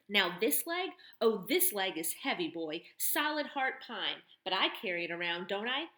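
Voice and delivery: mocking tough woman. Barn owner mocking the boy's question, loud and sardonic. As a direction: tough barn woman turning his words on him.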